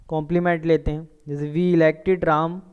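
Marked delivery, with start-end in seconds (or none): 1.5-2.6 very slow, each word separate; slow dictation. Shown as a demonstration. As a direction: read it slowly for students to copy. Teacher dictates example sentence. (धीरे-धीरे लिखवाना)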